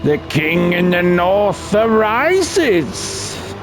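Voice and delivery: high, slow, drawn-out heralding cry; hammed-up royal announcement; mock proclamation. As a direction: stretch it out like a grand fantasy herald. Mock proclamation as Jon Snow returns.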